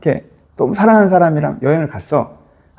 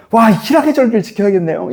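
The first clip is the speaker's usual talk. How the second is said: higher, wowed exclamation; imitated joyful resolve; delighted realisation; 4 semitones higher than usual. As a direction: react with fresh, eager delight. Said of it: "이 느낌".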